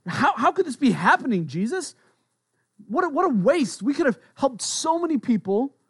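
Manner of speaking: high-pitched, appalled protest; incredulous, voice pitched well up; taken aback. Acted. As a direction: act out shocked disciples objecting. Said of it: "they were just taken aback".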